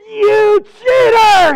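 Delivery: very loud, high, slow furious accusation; furious God. Acted. God furious at the lying woodcutter, roaring and outraged. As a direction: spit the words out as an outraged God. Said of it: "And God is furious".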